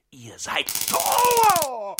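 high, loud, drawn-out yell; furious shout. Acted. Bound captive screaming a threat at his captors.

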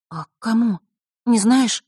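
put-on high, questioning girl's voice; alert girl. A narrator's reading. Vasilisa asks her friend about her father, alert high voice. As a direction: ask keenly, pitched high. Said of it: "Тут же насторожилась девочка".

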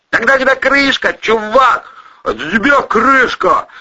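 loud, high yell; high, emphatic holler; shouted retort. A narrator's reading. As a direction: shout the grim answer over the chaos. Acted shouted exchange while sinking.

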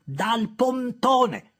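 very slow, high, loud retort; irritated sister drawing it out; curt snap. Acted. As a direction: bite off the answer. Sister snaps answer.

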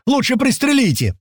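very high, desperate yell; panicked cry. Acted. Паштет begs his mates to shoot him, panicked.

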